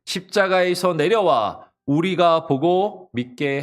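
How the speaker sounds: loud, high call, gradually slowing and dropping; mocking proclamation. Onlookers challenging the crucified Christ, mocking. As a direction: declaim it as a public taunt. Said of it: "사람들이 예수님을 조롱하고".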